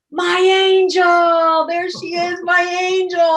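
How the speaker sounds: high, loud, slow and beaming; overjoyed greeting. Retold or imitated. Quest announcing her to the whole Starbucks, overjoyed and loud. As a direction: call it out across the room with delight.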